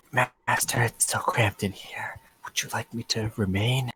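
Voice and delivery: slow hissing servant whisper; creepy dragon whisper. Acted.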